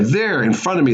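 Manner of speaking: ordinary delivery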